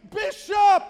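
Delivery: high, full-voiced call; yelling his name. Father searching the mall for his son, yelling his name. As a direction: holler the name across a crowd. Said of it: "I'm yelling for his name".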